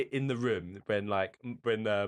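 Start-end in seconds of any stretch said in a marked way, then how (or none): none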